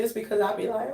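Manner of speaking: ordinary delivery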